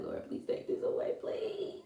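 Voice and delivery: imitating a broken, whispered plea to the Lord; whispered tearful prayer. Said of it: "just boo-hoo and crying, like".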